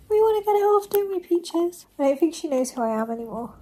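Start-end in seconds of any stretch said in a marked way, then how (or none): 0.1-1.8 pet voice. Acted. Talking to her guinea pig.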